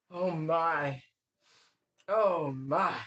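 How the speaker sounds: ordinary talk, nothing marked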